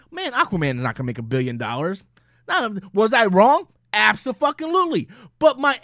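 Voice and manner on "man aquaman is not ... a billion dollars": lightly voiced own quote. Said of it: "like me going".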